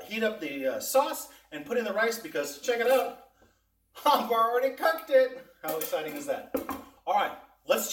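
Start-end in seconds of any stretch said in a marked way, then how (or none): 2.6-5.5 laughing. Real, not acted. Excited reveal of cooked rice.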